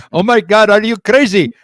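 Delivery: put-on loud, excited Indian accent. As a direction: imitate the Indian neighbor's accent, shouting. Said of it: "I even showed him my Indian accent".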